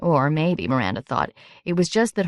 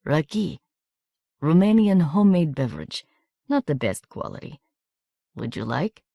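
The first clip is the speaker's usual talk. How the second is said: put-on host offering a drink in a Romanian accent; gentle Eastern European accent, phrase by phrase; soft foreign accent. A narrator's reading. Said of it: "in his soft accent".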